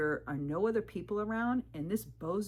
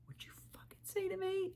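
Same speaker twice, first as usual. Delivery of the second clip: menacing man; 14 semitones higher, 7 dB quieter than usual. Retold or imitated. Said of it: "the micro expression on his face".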